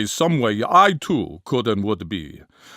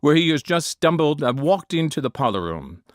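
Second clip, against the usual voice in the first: ordinary talk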